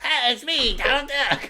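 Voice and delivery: high squawky cartoon duck; Donald Duck voice. Shown as a demonstration. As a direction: quack out a cartoon duck greeting. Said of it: "remember what Donald Duck sounds like".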